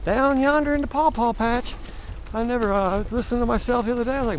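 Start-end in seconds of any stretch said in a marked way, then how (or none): 0.1-1.7 playful backwoods Southern accent. Shown as a demonstration. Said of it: "the southern redneck comes out in me".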